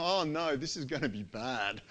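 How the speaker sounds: inner dread. Retold or imitated. You, confronted, softly dreading inside. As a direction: mutter it to yourself, dreading. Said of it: "And you go".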